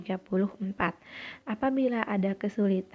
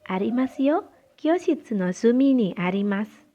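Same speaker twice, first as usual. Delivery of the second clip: loud model answer in Japanese; raised classroom reading voice; projected dialogue reading; 3 semitones higher, 7 dB louder than usual. Shown as a demonstration. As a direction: project the reply to the whole class.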